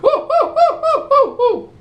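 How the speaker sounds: high, loud frantic ape whoops; panicky monkey hoots. Acted. Trapped performer hooting at a crowd that won't help, panicky. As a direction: hoot harder, panic creeping in. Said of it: "Gives a little more panic".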